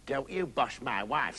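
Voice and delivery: low, slow, soft grandad; slow grandad standing up for his wife. A narrator's reading. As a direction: object in a low old-man voice. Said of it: "said a grandad".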